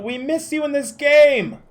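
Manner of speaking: loud, high repeated call. Real, not acted. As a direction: shout it again so she hears. Repeats shout to friend.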